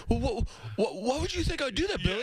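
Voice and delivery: a high, silly voice of a guy denying everything; mock indignant Kreischer. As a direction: squeal out the innocent denial.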